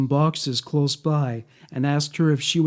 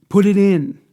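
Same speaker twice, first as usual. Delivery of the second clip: higher, louder short order; forceful command; 5 semitones higher, 8 dB louder than usual. A narrator's reading. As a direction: read it as a hard demand. Reads captor's order.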